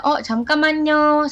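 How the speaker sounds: waiter's reply. Acted. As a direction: call back to a waiting customer. Acted waiter answering a customer. (pelayan menyahut dengan suara tinggi)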